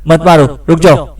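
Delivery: panicked plea at narrator's volume; restrained cry, begging in short urgent bursts; mock scream. A narrator's reading. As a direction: plead desperately without really screaming. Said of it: "Roger ने चीखते हुए कहा".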